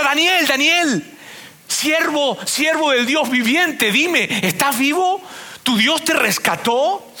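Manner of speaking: high, slow, drawn-out call; mock slow calling out. King at the lions' pit calling out to Daniel, high and slow. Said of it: "él grita".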